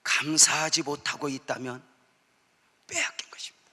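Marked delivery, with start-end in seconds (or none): 2.9-3.6 빼앗긴 것입니다 whispered, much softer. Real, not acted. Pastor whispers the warning to the congregation. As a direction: breathe 빼앗긴 것입니다 as a secret.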